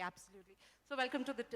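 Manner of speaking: normal talking voice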